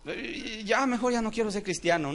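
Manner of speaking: high-pitched, wavering-in-faith imitation; lightly hammed-up alarm and retreat; mock intimidated believer. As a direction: act out someone backing away scared. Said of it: "No el que se amedrenta".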